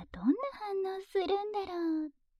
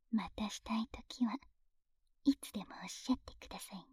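whispering; 5 semitones lower, 8 dB quieter than usual. Acted. Whispered teasing offer.

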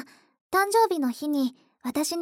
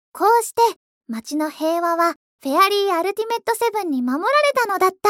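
story narration; 5 dB louder than usual. Acted. Narrates end of hero play.

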